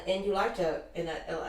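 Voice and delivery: usual speaking voice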